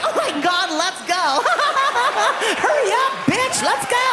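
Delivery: put-on high, squealing, laughing girly voice; giddy hot chick. Comic as hot chick hurrying to palace, high giddy squeal. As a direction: squeal it high like an excited party girl. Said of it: "I felt like a hot chick".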